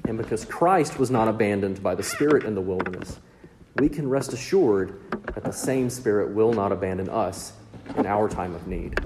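ordinary talk

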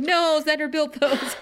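high, slow protest through a real laugh. Real, not acted. Host reacting to the scene, laughing protest. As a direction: cry out in mock dismay, laughing. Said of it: "And I was like".

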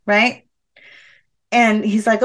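ordinary talk, nothing marked

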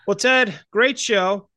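ordinary talk, nothing marked

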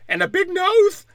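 hammed-up squeaky kid with a lisp; mocking T'Challa's son. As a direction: lampoon a lisping child confiding a secret. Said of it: "They're like".

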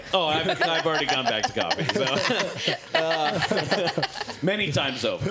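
laughing through the words. Real, not acted. Admitting to friends he went back to coffee, laughing. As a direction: giggle out an admission about coffee.

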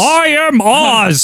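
high, slow, booming proclamation; booming wizard voice. Retold or imitated. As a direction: boom it out like the great wizard. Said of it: "the voice is a booming voice".

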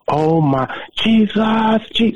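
high-pitched imitation of ecstatic shouting; retold screaming. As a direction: act out a woman crying out in praise. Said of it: "she was screaming, shouting".

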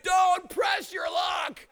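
high, drawn-out shouted warning; wife's shouted warning, imitated, stretched slow; retold shout. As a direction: call it out after someone running off. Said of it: "Carol shouted".

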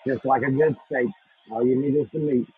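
usual speaking voice

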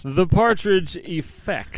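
slow, drawn-out title reveal; "The Partridge Effect" slowly, like unveiling a show; grand announcement. Acted.

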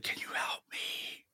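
slow, eerie whisper; imitated ghost voice. Imitating a voice caught on the recorder, whispered. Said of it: "pretty clear, dude. It's like".

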